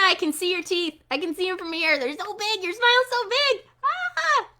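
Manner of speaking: high beaming teacher praise; happy. Pretend. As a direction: gush with delight at their smiles. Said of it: "Happy-ish".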